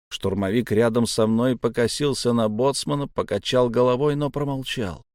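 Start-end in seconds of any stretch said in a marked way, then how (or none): none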